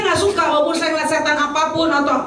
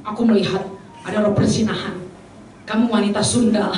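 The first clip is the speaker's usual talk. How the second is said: low, portentous seer's voice; mock rebuking prophecy; 4 semitones lower than usual. Preacher parodying a prophet exposing a woman's sin, low and ominous. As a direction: act out an accusing prophet, pitched low. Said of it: "menghardik loh, memberi nubuatan".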